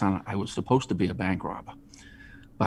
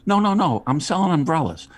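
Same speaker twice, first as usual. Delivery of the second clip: higher, louder mock-sarcastic answer; sarcasm; 9 semitones higher, 4 dB louder than usual. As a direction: drip sarcasm on the obvious. Sarcastic retort to tourist.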